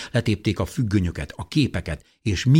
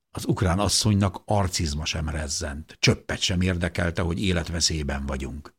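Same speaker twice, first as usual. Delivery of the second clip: ordinary delivery, nothing marked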